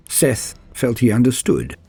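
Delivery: ordinary delivery, nothing marked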